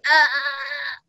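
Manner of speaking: strangled toy-goose squawk, imitated; mock choking cry. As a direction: choke out a short squawk. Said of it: "like it was choking".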